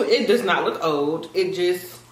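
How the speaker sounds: usual speaking voice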